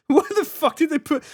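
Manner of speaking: startled high outburst; shocked. Real, not acted. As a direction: yelp it in disbelief. Reacting with shock to photo.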